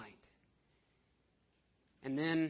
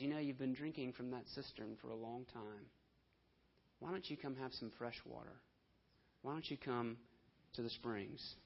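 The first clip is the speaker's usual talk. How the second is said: quiet, gentle coaxing as Jesus; a hushed, kind appeal; soft tender plea; 3 semitones lower, 7 dB quieter than usual. Acted. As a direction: invite them softly to fresh water.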